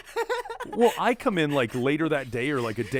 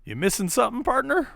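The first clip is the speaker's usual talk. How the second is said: higher, louder mock cowboy voice, with the last word pitched way up; 10 semitones higher, 4 dB louder than usual. Teasing the one-eyed cab driver, mock cowboy voice. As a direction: tease him like a swaggering cowboy. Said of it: "I was like".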